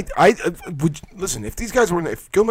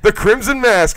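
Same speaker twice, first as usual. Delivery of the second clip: higher and louder hammed-up announcement; excited shout; 9 semitones higher, 9 dB louder than usual. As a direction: belt out the name like a ringside reveal. Calling out the bleeding wrestler.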